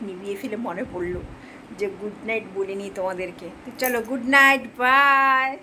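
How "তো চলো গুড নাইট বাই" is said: a bright, loud farewell to the viewers; cheery loud goodnight. Real, not acted. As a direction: call out goodnight and bye, louder and higher. (দর্শকদের জোরে হাসিখুশি বিদায়)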